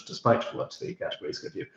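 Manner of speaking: ordinary talk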